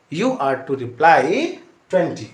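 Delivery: slow emphasis. Shown as a demonstration. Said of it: "slow emphatic teaching".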